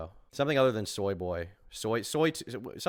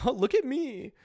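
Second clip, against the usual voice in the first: mock vanity; 14 semitones higher than usual. Mocking the vain selfie guy.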